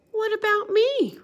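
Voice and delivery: very high, loud plaintive question; ask, feeling left out; Bear's question. Acted. Bear asking friends about himself, very high and plaintive. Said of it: "He says".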